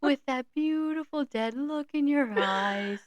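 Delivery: teasing lovestruck imitation; Gary impression. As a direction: gush sappily like a smitten suitor. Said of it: "how Gary talks".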